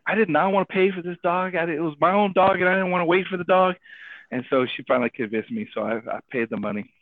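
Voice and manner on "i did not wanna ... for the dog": retold indignation in a raised pitch; mad. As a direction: protest fast and high, running the complaints together. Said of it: "I was mad".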